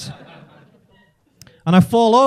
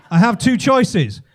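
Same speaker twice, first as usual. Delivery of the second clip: normal talking voice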